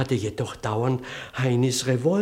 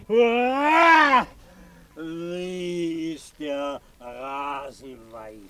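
put-on feigned drunk shout; 3 semitones higher, much slower than usual. Vicar rams the policeman, faking drunken madness. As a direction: yell it like a madman, then go dreamy. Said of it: "rannte ihm meinen Kopf in den Bauch und schrie".

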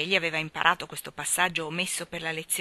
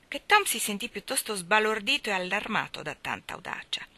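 ordinary delivery

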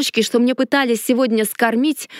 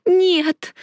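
moaning cry; 8 semitones higher than usual. A narrator's reading. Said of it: "застонала я".

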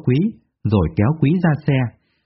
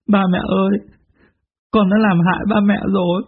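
sobbing lament; 6 semitones higher than usual. Acted. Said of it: "khóc nức nở".

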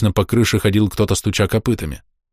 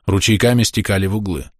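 ordinary delivery

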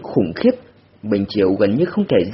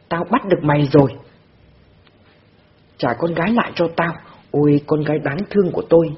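fast, throat-tearing wail; burning scream; 4 semitones higher, faster than usual. A narrator's reading. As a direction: scream yourself hoarse. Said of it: "gào lên xé họng". (gào xé họng)

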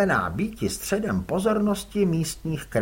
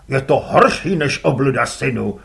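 louder gruff curt reply; gruff father; 8 dB louder than usual. Acted. Father answers his son curtly, gruff.

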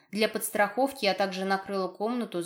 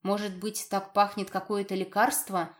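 usual speaking voice

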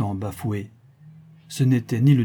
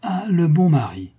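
higher-pitched, delighted exclamation; raised-voice mocking cry; crying out loud; 6 semitones higher, 4 dB louder than usual. A narrator's reading. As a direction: exclaim it aloud, highly amused. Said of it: "s'écria-t-il tout haut".

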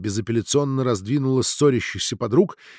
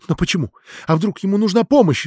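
higher-pitched, louder tearful objection; acted protest, wiping away tears; 7 semitones higher, 4 dB louder than usual. A narrator's reading. Mironova objects to the doctor while wiping tears, tearful protest. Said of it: "запротестовала Миронова, утирая слёзы".

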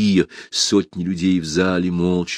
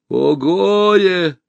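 high, loud, drawn-out lament; cry of woe; 11 semitones higher, 5 dB louder, much slower than usual. A narrator's reading. Voice from the crowd lamenting, high drawn-out cry. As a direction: wail it slowly and loudly. Someone cries out in grief.

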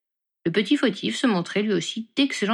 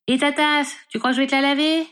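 loud, higher call across the room; shouted after him; 7 semitones higher, 4 dB louder than usual. A narrator's reading. Sister shouting after her brother as he leaves. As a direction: yell it at his back. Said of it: "hurla Ginny dans son dos".

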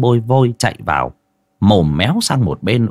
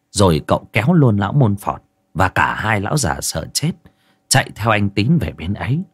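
ordinary delivery, nothing marked

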